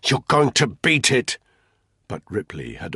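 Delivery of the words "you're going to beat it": loud, higher encouraging shout. A narrator's reading. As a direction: call out hope across the rain. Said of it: "Then he shouted".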